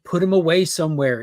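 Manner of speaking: normal talking voice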